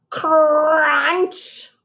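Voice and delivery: crisp, high crunch; playful crunch; crunch sound. Acted. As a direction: bite the word like a carrot. Sound effect in story.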